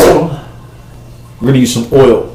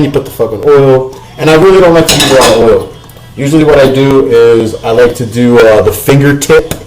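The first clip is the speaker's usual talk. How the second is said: ordinary delivery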